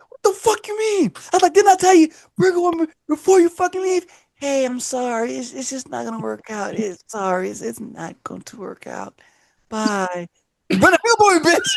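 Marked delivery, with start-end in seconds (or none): 0.1-4.0 high, heated outrage; angry demand. Retold or imitated. Retold breakup call anger. 4.4-10.3 much softer, slowing apology; soft breakup voice. Retold or imitated. Girlfriend breaking up softly.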